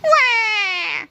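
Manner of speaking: high cartoon bawl; long, high mock-crying wail; sobbed wail. A narrator's reading. Melvin bawling over lost superpowers, sobbed wail. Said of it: "sobbed Melvin".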